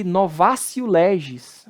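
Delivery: novatio as "novásio"; Latin T as C. Shown as a demonstration. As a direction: model novatio with an S sound. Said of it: "o T de novo aí com som de C".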